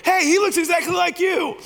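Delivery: squeaky high mock voice of the crowd. Everyone quoted on the video.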